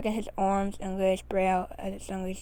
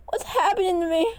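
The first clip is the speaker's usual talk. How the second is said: high, loud frightened wail; panicked cry; 10 semitones higher, 6 dB louder than usual. A narrator's reading. Panicked character crying out.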